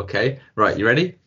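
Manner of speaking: ordinary talk, nothing marked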